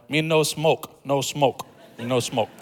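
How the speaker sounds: lightly imitated broken English, short separate phrases. Mat seller turning down the preacher's pitch, in broken English. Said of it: "those who have broken English".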